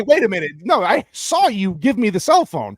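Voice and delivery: indignant demand, acted out; hammed-up angry questioning; mock indignant guard. Guard confronted about phone.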